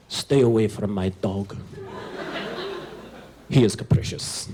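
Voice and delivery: put-on a gruff old man guarding his dog; mean old Ukrainian grandpa. Grandpa warning the American boy off his dog, low and mean. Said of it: "can make any word sound mean".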